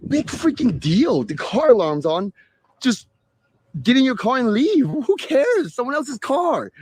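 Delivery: high, slow, scoffing brush-off; high-pitched mock exasperation, who cares; exasperated retort. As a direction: wave it away as nothing. Voicing her own retort.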